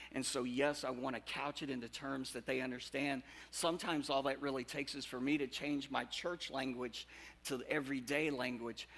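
ordinary delivery

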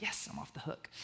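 breathy whispered aside, secretly relieved. Retold or imitated.

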